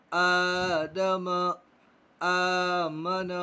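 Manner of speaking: आदम with the first आ held long; slowly drawn out. Shown as a demonstration. Teacher drawing out आदम for the class. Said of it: "खींचेंगे".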